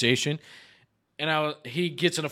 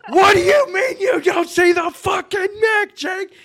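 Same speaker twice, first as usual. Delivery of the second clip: much higher, loud laughing yell; half-laughing incredulous scream; exasperated shout; 10 dB louder than usual. Retold or imitated. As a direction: shout it in disbelief at your teammate. Yelling at teammate retold.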